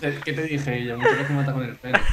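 words through laughter; laughing while talking. Real, not acted. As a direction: talk while cracking up. Laughing at own death.

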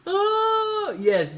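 high, drawn-out oh of realization; sudden realization. Real, not acted. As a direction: it finally clicks. Realizes the answer.

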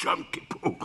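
voiceless demonstrative whisper; whisper in the ear. Shown as a demonstration. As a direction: whisper it secretly into her ear. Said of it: "תלחשו לה באוזן". (לחישה באוזן)

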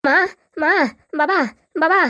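son calling out for his parents. Acted. Distressed son summoning his mother and father, calling out. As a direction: call each word separately, summoning help. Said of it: "ডাকছিস কেন".

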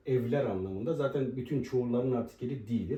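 usual speaking voice

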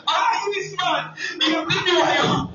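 mock scolding. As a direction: scold him through laughter. Wife scolding husband, laughing.

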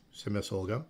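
usual speaking voice

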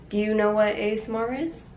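ordinary talk

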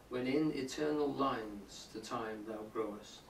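slow, measured sonnet recital; poem reading. Acted. Teacher reading a sonnet aloud to the class, measured recital. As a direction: read each line with weight and care.

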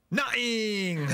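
put-on high, loud little boy's nothing; the boy answering Santa with a cheeky shout; cheeky kid. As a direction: blurt Nothing! like a cheeky toddler. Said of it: "looks around and goes".

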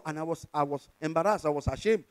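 ordinary talk

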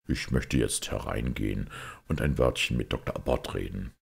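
a quiet decision after thinking it over; Pater Brown, thoughtful. A narrator's reading. Priest deciding to speak with the doctor, low and thoughtful. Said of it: "Pater Brown überlegte einen Augenblick und sagte dann".

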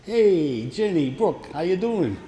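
bright call across the dojo; cheerful greeting. Real, not acted. Sensei greets his daughter and granddaughter walking in, calling out. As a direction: call out a happy hello.

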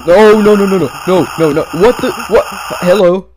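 high, loud, frantic pleading; real panic shouts; terrified yelling. Real, not acted. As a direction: scream it as if being chased. Chased in horror game.

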